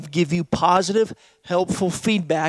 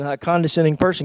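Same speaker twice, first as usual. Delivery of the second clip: ordinary talk, nothing marked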